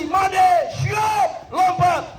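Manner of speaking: high shouted jump order; komandan memanggil. Acted. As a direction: bark out the name and order, high-pitched. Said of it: "Panggil dari Bali". (aba-aba komandan, nada tinggi)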